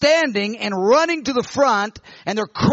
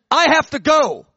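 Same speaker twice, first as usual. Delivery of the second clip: higher, louder sudden urge; urgent; 6 semitones higher, 6 dB louder than usual. Retold or imitated. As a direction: blurt it out, you must go now. Urgent cry in the dream.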